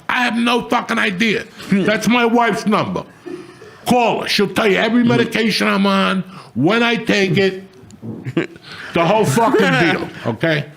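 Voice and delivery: high, laughing exasperation; half-laughing, half-furious venting; high, laughing retold scolding of the hospital staff; exasperated retelling. Retelling telling off the nurse.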